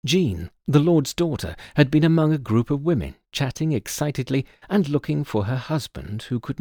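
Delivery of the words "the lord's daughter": ordinary delivery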